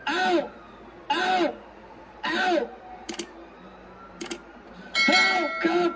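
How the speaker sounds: ordinary talk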